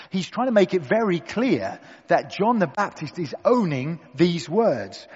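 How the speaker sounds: usual speaking voice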